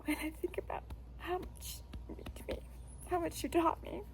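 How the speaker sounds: choked up. Real, not acted. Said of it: "Sometimes I get choked up".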